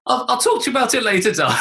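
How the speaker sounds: high, quick, laughing reply; highest voice. Retold or imitated. Dad putting daughter off in the loos, highest voice. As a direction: hurry it out high, fighting laughter. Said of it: "in my highest voice I could".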